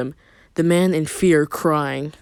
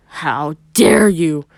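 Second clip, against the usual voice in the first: angry; 6 dB louder, much slower than usual. A narrator's reading. Sakura about to punch.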